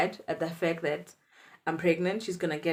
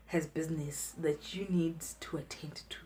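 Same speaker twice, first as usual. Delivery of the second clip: conspiratorial whisper; aunt hears the secret in a whisper; 6 dB quieter than usual. Retold or imitated. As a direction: breathe it secretly.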